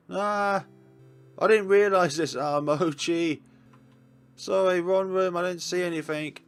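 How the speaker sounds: loud, high startled yelp, then embarrassed apology; flustered surprise. Acted. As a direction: blurt it out as if you walked in on something. Barging in on couple, apologizing.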